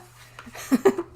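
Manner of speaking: quick loud laugh at her own joke. Real, not acted. Joking to chat about her sleeve, loud laugh. Laughing at calling herself a minger.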